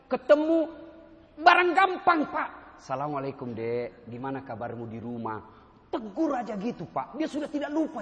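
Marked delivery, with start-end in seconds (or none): 2.8-5.4 low, soft fatherly greeting; a commander kindly checking on his junior; sapaan ramah. Acted. Models friendly greeting to subordinate. (menyapa anak buah dengan lembut)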